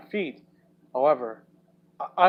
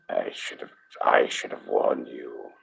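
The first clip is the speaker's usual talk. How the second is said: deep regretful voice; 5 dB quieter than usual. Acted. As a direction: sound sorry and low. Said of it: "a regret laced in his voice".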